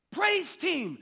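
high, loud reply; eager answer. Retold or imitated. Man on the cliff answering, high and loud. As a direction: call it out proudly. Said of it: "And the man said".

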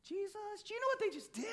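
high, hushed tattling Pharisee; prissy Pharisee telling on the disciples; scandalized Pharisee. Retold or imitated. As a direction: gasp and tattle to Jesus. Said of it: "the Pharisees are like".

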